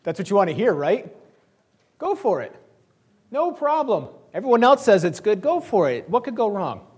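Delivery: "Go for it" dripping with mock cheer; sarcastic agreement. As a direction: sell the sarcasm, wink at him. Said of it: "Micaiah goes in basically".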